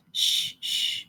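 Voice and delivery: soft breathy shushing; hushing. Acted. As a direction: hush her gently, no voice. Mildred hushing crying friend.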